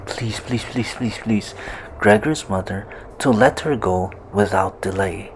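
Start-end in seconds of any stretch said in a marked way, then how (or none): 0.1-1.5 soft, rapid-fire pleading; acted quiet imploring; begging. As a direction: beg in a hushed, repeated stream.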